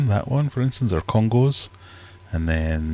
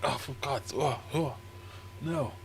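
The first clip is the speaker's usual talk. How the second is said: much softer, higher, whiny protest; annoyed sleepy fish; 7 semitones higher, 12 dB quieter than usual. Pretend. Discus complaining as the lights come on, soft and high. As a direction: moan it quietly like a fish blinded by the light. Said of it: "They were a bit like".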